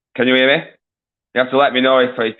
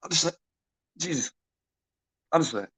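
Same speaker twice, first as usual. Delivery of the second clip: exasperated mutter; 13 dB quieter than usual. Real, not acted. Host gives up in exasperation.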